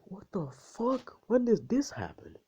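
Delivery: high-pitched, mock-shocked outcry; shocked outburst. Mom reacting to the kiss, mock-shocked. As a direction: exclaim it high and drawn out, imitating disbelief.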